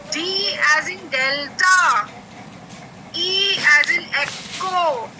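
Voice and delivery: each word drawn out very slowly; slow spelling alphabet drill. Shown as a demonstration. Teacher leading the class in a slow drill. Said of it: "मेरे साथ-साथ बोलना है".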